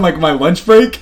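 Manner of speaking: ordinary talk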